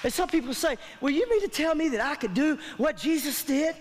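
ordinary delivery

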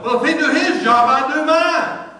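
loud, high, slow defiant snap; mock self-righteous shout; indignant retort. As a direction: throw it back hard, tit for tat. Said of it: "instead of me going".

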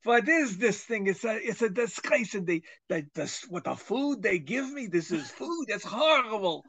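put-on high kvetching Yiddish accent, griping about dog food. Talking dog gripes about the food it gets, in a high Yiddish accent. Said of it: "Do the accent".

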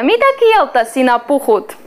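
ordinary talk

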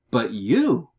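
"you" punched loud; stress on "you". Shown as a demonstration. Teacher translating an emphatic Greek pronoun, with "you" strongly stressed. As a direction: lean hard on the word "you". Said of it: "emphatic then".

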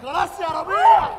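high loud plea to stop. Acted. Comrade begging Rabie to stop, high and loud. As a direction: beg him loudly to stop. Calming a scuffle.

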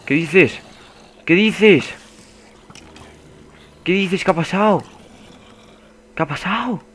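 high-pitched, incredulous exclamations; high, baffled outburst; shocked disbelief. Real, not acted. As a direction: cry out in stunned disbelief. Shocked at what happened in game.

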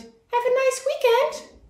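put-on high, cheerful little girl; little girl Ruby. A narrator's reading. Ruby answers Miss Hart, high little-girl voice. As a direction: chirp it back like a small girl. Ruby's voice.

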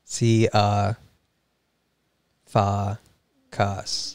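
C, uh, fa, kas sounded out one by one as "see-AH, FAH, KAHS"; soft, halting "C, uh, fa, kas" with open ah vowels, long gaps between; ah-sound syllables. Shown as a demonstration. Learner repeating a Greek surname, C, uh, fa, kas slowly with ah sounds. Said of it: "say each one of them with an ah sound".

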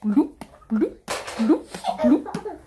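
playful bubbly bloop sounds; jellyfish impression. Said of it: "That's my impression of a jellyfish".

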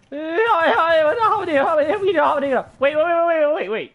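high, loud, flustered repeats; excited babbling. Real, not acted. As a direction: blurt it out in a rush. Sudden surprise in game.

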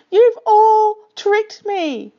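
slow, high, loud wailing accusation; howling. A narrator's reading. Dingo accusing the animals, outraged howl. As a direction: wail it at the whole crowd. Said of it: "he howled".

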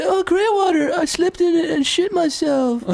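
high mocking voice; mock movie line. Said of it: "Is it".

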